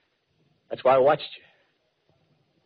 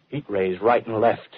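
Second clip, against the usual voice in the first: usual speaking voice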